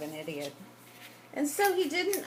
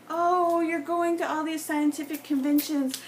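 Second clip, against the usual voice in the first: high, loud, gushing retold voice; mock-enthusiastic wife, higher than usual; eager wife; 7 semitones higher, 4 dB louder than usual. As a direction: imitate an over-interested wife, pitched up and pleading. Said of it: "'Cause she was like".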